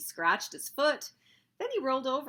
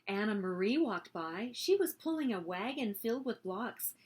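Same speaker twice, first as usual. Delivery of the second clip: plain reading voice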